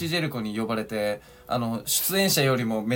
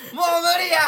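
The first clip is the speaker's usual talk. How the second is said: much higher, louder cry of giving up; exasperated shout; 6 dB louder than usual. Real, not acted. Fed up with his partner, shouting that he's done. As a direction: throw your hands up and yell. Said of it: "大声出さすなよ".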